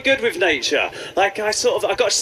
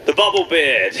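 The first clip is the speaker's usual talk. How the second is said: ordinary delivery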